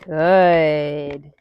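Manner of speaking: ordinary talk, nothing marked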